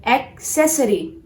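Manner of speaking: "accessory" as ak-SESS-uh-ree; "accessory" with a clear K; correct accessory. Shown as a demonstration. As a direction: split AK from SESS in "accessory" for repeating.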